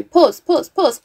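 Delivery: frantic gamer hitting pause; panicked pause. Real, not acted. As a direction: scramble to stop it in time.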